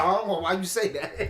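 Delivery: loud, high burst of protest; startled objection. Retold or imitated. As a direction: blurt it out loudly, mock-startled. Replays own startled interruption, laughing.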